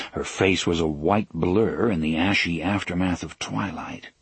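plain reading voice

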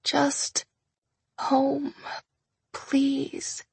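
high, soft, pained plea; weak pleading. A narrator's reading. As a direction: beg weakly to go home. Injured wife pleading softly.

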